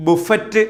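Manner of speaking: ബുഫറ്റ് with the final t sounded; common misreading. Shown as a demonstration. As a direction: say ബുഫറ്റ് as "boo-FET-uh". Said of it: "ചില ആൾക്കാർ ഇത് വായിക്കുന്നത്".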